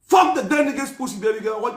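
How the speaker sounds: normal talking voice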